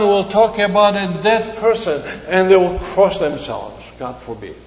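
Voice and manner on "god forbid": ordinary delivery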